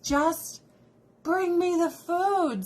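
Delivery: high, slow, whiny plea. Retold or imitated. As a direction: drag out "bring me the food" like a starving diner. Impatient diner begging.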